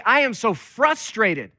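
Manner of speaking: slow, high-pitched emphatic venting; acted frustration. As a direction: vent to God, stretching the words out. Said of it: "bring all of those feelings to God".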